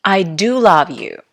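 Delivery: "do" stressed firmly for emphasis. Shown as a demonstration. Teacher repeating an example for noticing, stress on "do". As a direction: put the weight on "do". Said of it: "how I pronounce these sentences".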